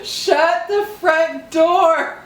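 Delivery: high, slow astonished exclaim; amazed. Real, not acted. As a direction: stretch it out in disbelief. Amazed at tongue twister.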